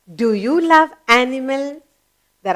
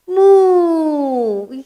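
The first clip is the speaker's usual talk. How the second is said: long drawn-out moo, higher pitched; cow's moo; 5 semitones higher than usual. Shown as a demonstration. Teacher showing children what the cow says, long imitated moo. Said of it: "Have you heard the cow say?".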